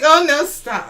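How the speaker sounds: hammed-up toothless objection; Joe Biden. Mocking a president telling Netanyahu to stop, in a high mock protest. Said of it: "That's how Joe Biden said".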